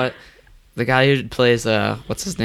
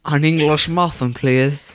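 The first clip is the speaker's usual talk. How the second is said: put-on English accent; 5 semitones higher, slower than usual. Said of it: "English accent".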